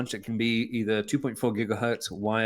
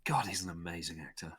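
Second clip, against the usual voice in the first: whisper; 5 semitones lower, 9 dB quieter than usual. Real, not acted. Awed whisper about actor.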